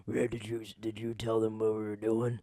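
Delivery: lightly voiced TV villain. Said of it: "Oh, he's doing a villain voice".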